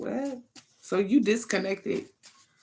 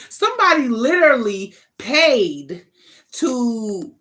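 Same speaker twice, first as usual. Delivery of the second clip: higher, louder, slow astonished stress; emphatic reveal; 5 semitones higher, 8 dB louder, slower than usual. Real, not acted. Reader stressing the shocking payment to viewers. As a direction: lean hard on the shock.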